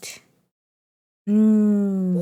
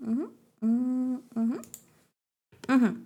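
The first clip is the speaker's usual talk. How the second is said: normal talking voice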